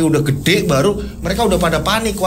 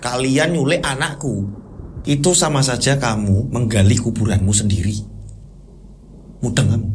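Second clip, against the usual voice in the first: measured and ominous, pitched low; menacing shaman; 5 semitones lower, 3 dB quieter than usual. Acted. Shaman to couple who took his child, low menace.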